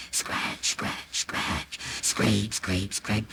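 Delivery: whispered, rhythmic scrape; secretive, very soft chant; hushed scratching sounds. A narrator's reading. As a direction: imitate furtive scratching under the breath. Imitates secret scraping at the wall.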